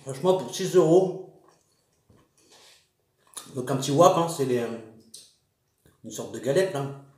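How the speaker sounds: chewing while talking; speaking between bites of wrap; mouth full. Real, not acted. Seen 3: Bit wrap at -4s; full cheeks, wrap held near mouth throughout.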